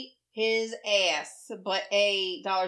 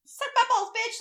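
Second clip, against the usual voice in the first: high, loud sassy insult; playfully mocking a friend's trash talk; hammed-up rude jab; mock crude taunt; 13 semitones higher, 3 dB louder than usual. Imitating friend's rude posts.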